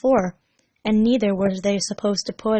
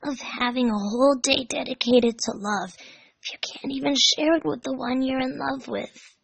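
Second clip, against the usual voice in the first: put-on young Tails voice; 5 semitones higher than usual. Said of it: "he muttered quietly to himself".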